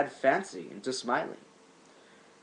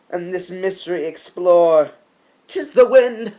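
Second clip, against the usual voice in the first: louder, higher and urgent, rising to a cry; agitated loud speech; 4 semitones higher, 8 dB louder than usual. Acted. Narrator at the window convincing himself, agitated loud. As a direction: steel yourself aloud against the fear. Soul burning, hears louder tapping.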